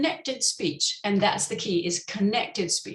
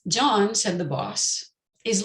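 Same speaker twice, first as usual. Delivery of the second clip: John said the boss is lazy, slow and measured, John stressed, falling tune; teacher modelling the sentence slowly; slow demo reading; slower than usual. Shown as a demonstration. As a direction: spell out the intonation slowly for students. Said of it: "let's try this one time".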